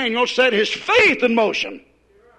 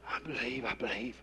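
much softer, lower, limp "I believe"; mock the weak sweet believer; mock sweet and tender; 10 semitones lower, 18 dB quieter than usual. Preacher mocks timid Christians' prayer, soft and limp. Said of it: "so sweet and tender and go up and say".

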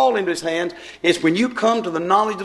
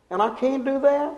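indignant question; 8 semitones higher than usual. Retold or imitated. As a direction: voice jumps high in disbelief. Brother protests to the captain.

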